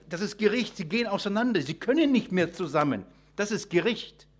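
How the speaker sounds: normal talking voice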